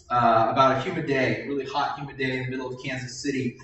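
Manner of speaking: usual speaking voice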